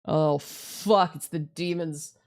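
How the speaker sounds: normal talking voice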